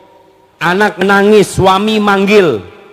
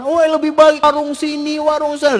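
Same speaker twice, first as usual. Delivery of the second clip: high, contrary mock argument; wife contradicting her husband in the car; bickering wife; 7 semitones higher than usual. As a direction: argue back over which stall. Couple bickering about a food stall.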